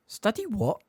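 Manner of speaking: high, puzzled question; blank incomprehension. A narrator's reading. Harry queries Snape's unfamiliar word, blankly. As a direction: echo it without understanding. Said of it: "said Harry blankly".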